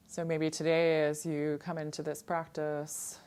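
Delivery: calm, unhurried yoga-teacher voice with long pauses; guided meditation. Real, not acted. Yoga teacher guiding students to settle in and draw awareness inward, calm meditation voice. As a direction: settle them gently inward, phrase by phrase. Leads seated meditation opening.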